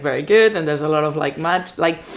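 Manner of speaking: ordinary delivery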